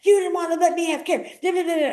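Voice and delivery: high, mocking whine of a complaining caller; lightly mocked complainer; mocking whiny inmate. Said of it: "he's like".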